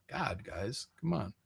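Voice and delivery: ordinary delivery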